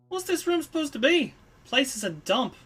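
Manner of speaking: high, scornful character voice; unimpressed Kid. Acted. Kid looking into a dusty room, unimpressed. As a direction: play a brash youngster turning up their nose.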